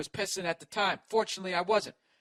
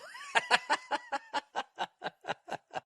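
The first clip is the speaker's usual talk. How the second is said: high laugh. Real, not acted. Laughs at own joke.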